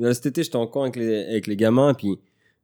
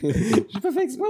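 whiny protest; slower than usual. Real, not acted. Defending himself, whiny protest.

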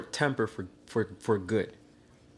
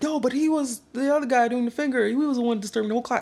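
high-pitched, rushed defence; urgent protest; 12 semitones higher, faster than usual. Retold or imitated. Classmates defending him to the teacher, high urgent protest. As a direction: imitate classmates pleading his case.

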